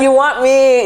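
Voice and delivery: shouted, pitch jumping up; loud mocking taunt. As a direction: yell it as a smug taunt.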